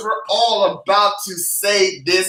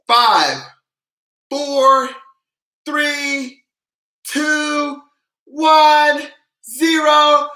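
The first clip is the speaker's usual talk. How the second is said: called-out numbers, climbing high and loud; building countdown; 6 semitones higher, 3 dB louder, much slower than usual. Real, not acted. Host counting viewers down to the scream, building call. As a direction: count down with pauses, rising to a loud zero.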